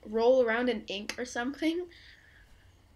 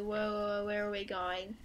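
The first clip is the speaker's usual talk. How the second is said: ordinary talk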